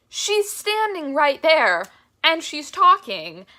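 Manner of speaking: high, loud and slow, marvelling; overwhelmed awe. Retold or imitated. Fan reliving the speech at the concert, overwhelmed.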